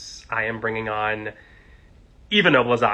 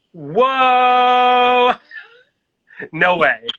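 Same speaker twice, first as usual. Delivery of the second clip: high, loud delighted whoa; real gasp of delight; surprised; 11 semitones higher, 10 dB louder, much slower than usual. Real, not acted. Host seeing his guest join the stream, surprised.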